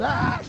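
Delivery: high short scream; a quick high shriek; mock scream. Thief grabbed by the collar, high startled scream. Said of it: "she said".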